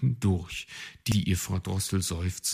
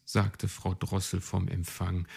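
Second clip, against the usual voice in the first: usual speaking voice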